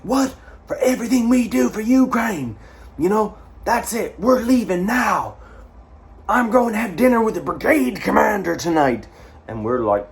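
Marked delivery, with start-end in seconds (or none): 0.0-9.1 high, loud pompous indignation; arrogant outrage. Retold or imitated. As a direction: puff yourself up, offended and superior. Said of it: "You're an arrogant bastard".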